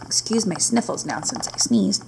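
lively storybook narration; reading to children. A narrator's reading. Teacher reading a camel story to her class, lively storybook read. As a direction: read it aloud to the little ones. Reads picture book aloud.

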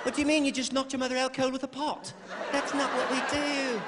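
high prim nanny, never loses her cool; calm nanny. Retold or imitated. Nanny scolding child who knocked mother out. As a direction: gently scold a wild child. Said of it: "She's like".

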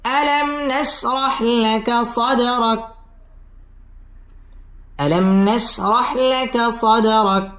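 slow, drawn-out melodic tajweed chant; Quran recitation. Shown as a demonstration. Teacher reciting the verse for students, slow melodic chant. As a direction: chant each word long and sung. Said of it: "Izhare খাস".

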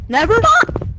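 loud panicked shout; panicked yell. Real, not acted. Fleeing a chaser in game.

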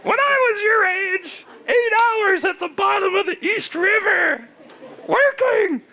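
put-on high, straining old-man rant; ranting granddad. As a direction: lecture the youngsters in a cranky high voice. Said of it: "I feel like your granddad".

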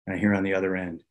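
ordinary talk

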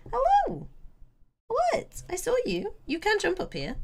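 very high cooing to a cat; pet talk. Real, not acted. Streamer invites the cat up, high pet-talk voice. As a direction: pitch it right up for a pet.

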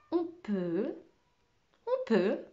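put-on boy stalls for time to work out the sum, slow; slowly, stalling. A narrator's reading. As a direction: stretch it out, buying time. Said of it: "fit lentement Camo".

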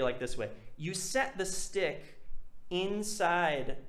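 ordinary talk

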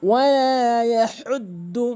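slow recited ইয়াহুদ্দু; Quranic recitation. Shown as a demonstration. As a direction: chant it. Recites next verse.

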